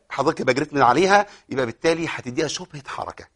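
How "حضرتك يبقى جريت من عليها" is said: usual speaking voice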